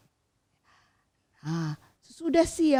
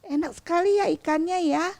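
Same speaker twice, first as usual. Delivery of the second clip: put-on higher, quick delighted child; happy child; 7 semitones higher than usual. A narrator's reading. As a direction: savor the fish like a happy kid. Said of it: "senang sekali makan ikan". (anak kecil senang dengan suara lebih tinggi)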